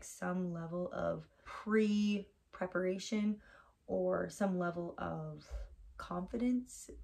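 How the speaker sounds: ordinary talk, nothing marked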